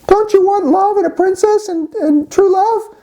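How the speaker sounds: high falsetto pleading; frog princess voice. Acted. Frog tempts boy with true love, high falsetto. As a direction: coax in a squeaky, hammed-up frog voice. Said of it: "she says".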